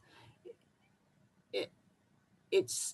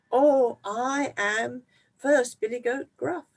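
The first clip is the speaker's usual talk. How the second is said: higher, small goat voice; the little goat answering the troll timidly; 6 semitones higher, 4 dB louder than usual. Acted. Littlest goat introducing himself to the troll, higher voice.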